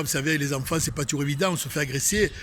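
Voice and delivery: usual speaking voice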